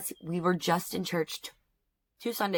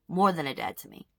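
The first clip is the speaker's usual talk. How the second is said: ordinary talk, nothing marked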